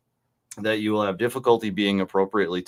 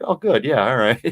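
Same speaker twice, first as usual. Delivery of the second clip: high, louder pleased reaction; retold relief; 5 semitones higher, 6 dB louder than usual. Reader finding something positive in the step, relieved. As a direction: perk up in light mock relief.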